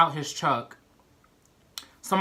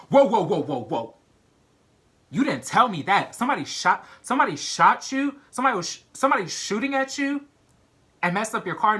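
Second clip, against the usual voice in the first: alarmed; 5 semitones higher than usual. Retold or imitated. Dispatcher suddenly realizes shooting.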